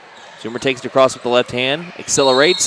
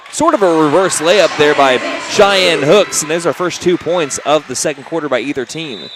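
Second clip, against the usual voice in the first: excited; 3 semitones higher, 4 dB louder than usual. Real, not acted. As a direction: celebrate the first score. First basket after long drought.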